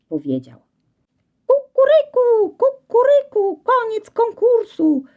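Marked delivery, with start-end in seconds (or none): 1.5-5.0 very high, slow crowing call, louder; rooster crowing. Acted. Rooster announcing the end of the contest, crowing. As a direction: crow it out like a rooster. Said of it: "zapiał wreszcie kogut".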